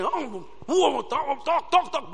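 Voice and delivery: high, slow sing-song mock of a meddling woman; mocking quarreling woman. Preacher mocking a woman bursting into a quarrel. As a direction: drag out the words like a nagging busybody.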